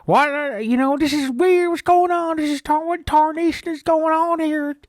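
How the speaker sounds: high, loud yokel voice. Retold or imitated. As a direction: squeal it out in disbelief. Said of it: "he's like".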